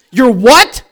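loud, high mock disbelief; shocked outburst. Woman on the phone hears of a wife, loudly shocked. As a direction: blurt it out, scandalized.

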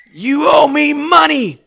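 slow, shouted threat; menacing demand. Acted. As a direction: lean in and demand the money. Servant threatening his debtor.

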